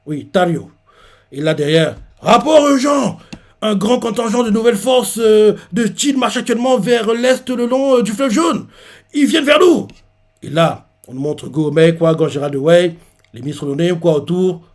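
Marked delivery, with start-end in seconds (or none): 2.2-9.9 urgent report. Retold or imitated. Messenger brings urgent report.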